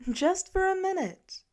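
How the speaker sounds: mother calling to the car; calling back. A narrator's reading. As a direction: insist over the distance.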